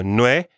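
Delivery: "nueve" split as nwe, ve; two syllables. Shown as a demonstration. Teacher breaking the word down for learners, two-beat "nueve". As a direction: give "nueve" two separate beats. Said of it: "also only two syllables".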